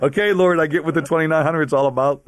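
high, loud laughing aha to God; delighted laugh, getting it at last; laughing realization. Retold or imitated. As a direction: burst out laughing at the sign. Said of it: "It's like".

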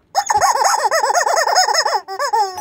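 loud high cackle; high burst of laughter. Real, not acted. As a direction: cackle at your own joke. Laughs again.

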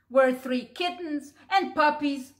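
ordinary delivery

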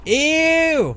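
loud, high disgusted "Ew!". Real, not acted. Player grossed out by flying brains, loud ew. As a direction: recoil in real disgust.

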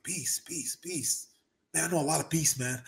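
lightly voiced boxer searching his memory; softly mulling over a name; quiet Mike Tyson musing. Mike trying to recall name.

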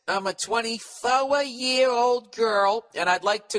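high, slow put-on young woman; hammed-up high girlish reading. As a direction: read it like a sweet girl, slowly. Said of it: "She says".

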